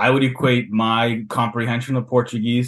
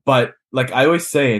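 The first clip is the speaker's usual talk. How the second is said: usual speaking voice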